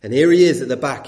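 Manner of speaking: normal talking voice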